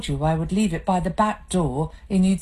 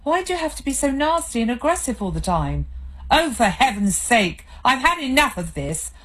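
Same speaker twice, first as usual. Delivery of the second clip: angry; 7 semitones higher than usual. Acted. Escalating marital row.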